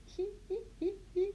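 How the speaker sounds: high, soft giggle; high soft tittering; quiet giggle. A narrator's reading. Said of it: "starts to giggle quietly".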